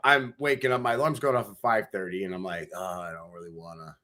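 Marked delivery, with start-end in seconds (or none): 2.7-4.0 very soft, sleepy grumble; acted-out half-awake protest; groggy reluctance. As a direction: groan it quietly from under the covers. Retells waking to the alarm.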